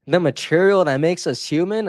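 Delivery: teasing repeat with a big rising question; mock incredulous echo. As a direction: echo his own words back in playful disbelief. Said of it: "Okay, Mister Philosopher".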